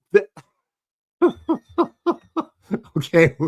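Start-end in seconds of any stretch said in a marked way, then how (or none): 1.2-2.4 high-pitched burst of laughter; laughing. Real, not acted. Bursts out laughing mid-story.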